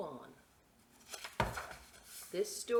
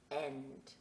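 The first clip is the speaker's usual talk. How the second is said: plain reading voice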